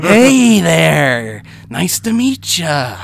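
scary person trying to sound nice; loud, high, drawn-out menacing friendliness; Jack Nicholson. Shown as a demonstration. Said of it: "who's trying to sound nice".

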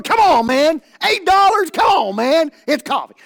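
high, shouted disbelief; loud protest. Acted. Customer balking at an eight-dollar foo-foo coffee, loud protest. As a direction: protest loudly, pitched right up.

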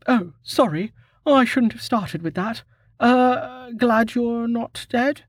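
high voiced woman, sheepish and fumbling; backpedalling after a rude greeting, slowing at the end; high, slow, sheepish female voice; awkward young woman. Maharia apologises awkwardly.

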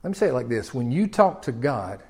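hushed pastoral appeal; gentle plea. Acted. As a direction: lean in and plead quietly. Softens to an intimate plea.

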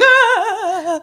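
short high cry of release; frustrated yell. Retold or imitated. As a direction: let it burst out high, like a scream in the car. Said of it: "I'd be driving away from therapy session like".

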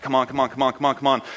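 hurried, repeated urging; lightly acted anxious impatience; mock panic. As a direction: urge it along as a mildly panicked wife. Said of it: "calls him in a panic".

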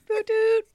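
high playful toot toot; mouthed train whistle. Pretend. Sidetrack train sound.

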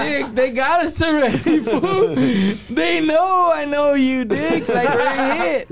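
high exasperated retort through laughter; retold exasperation. Arrested man answering his co-defendant in court, laughing exasperation. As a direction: laughingly act out telling a friend it's hopeless.

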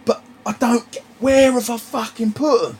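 high-pitched, exasperated outburst; retold annoyed shouting. Retelling to a mate how he was shouting at his missus, annoyed. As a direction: act out losing it over the keys. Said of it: "I was getting annoyed".

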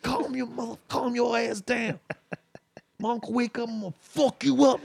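high, strained hushed scolding; upset. Retold or imitated. Friend warning pal his uncle will wake, upset. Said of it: "And then Trey got upset".

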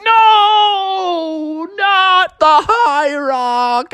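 long, high cartoon "no"; hammed-up drawn-out protest; dismayed wail. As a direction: wail it slowly in dread. Froggy dreads the High Rock.

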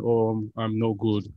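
a slow, lightly voiced tired father; imitating dad. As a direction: slowly mimic his weary complaint. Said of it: "it's what he always says".

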